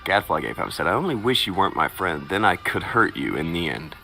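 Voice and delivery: usual speaking voice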